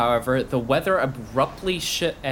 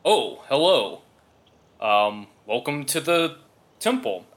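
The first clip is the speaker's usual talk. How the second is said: put-on a rough first go at the old dwarf's voice; first try at dwarf voice. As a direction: try out the voice, not quite there. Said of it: "Let me get this voice right".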